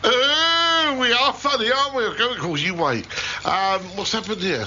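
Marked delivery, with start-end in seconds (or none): 0.0-2.0 high, mock-amused comeback; sarcastic, pitched well up, drawn-out opening; sarcastic retort. Real, not acted. Teased presenter answering the nipple joke, sarcastic.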